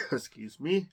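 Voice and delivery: ordinary talk, nothing marked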